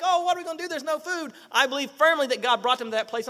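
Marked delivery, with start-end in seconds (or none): 0.0-1.3 higher-pitched lament, lightly acted; mock worry. Preacher imitates Israelites with no food, mock worry. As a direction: fret like a doubting follower.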